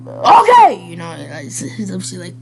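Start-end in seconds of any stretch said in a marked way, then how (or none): 0.1-0.9 high, loud sudden yelp of a yell; half-serious yell. Shown as a demonstration. Reactor acting out the past for viewers, mock yell. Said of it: "I don't know how to yell".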